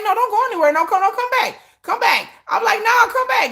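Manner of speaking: high, loud teasing call; mock calling back. Creator teasing commenters who run away, high teasing call. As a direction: call them back like luring a runaway.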